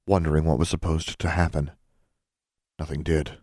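usual speaking voice

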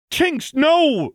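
high urgent shout; desperate cry. A narrator's reading. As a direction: cry out to stop her. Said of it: "Lux's cry".

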